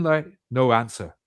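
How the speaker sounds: ordinary talk, nothing marked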